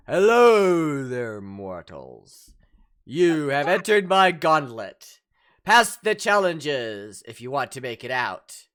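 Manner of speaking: loud, slow godlike voice from above; booming voice from the sky. Acted. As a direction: boom it down like a god from the sky. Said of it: "a booming voice from the sky goes".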